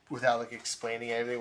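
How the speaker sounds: normal talking voice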